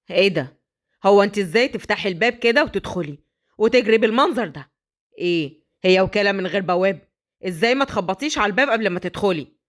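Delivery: low, irritated scolding; stern father. Acted. Rich father scolding the nanny for barging in, low and irritated. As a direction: put the maid in her place.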